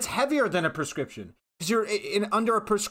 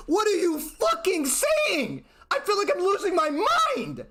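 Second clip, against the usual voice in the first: put-on exasperated girlfriend; 8 semitones higher than usual. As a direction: stretch it out, pitched up in outrage. Girlfriend reacting to racist remark.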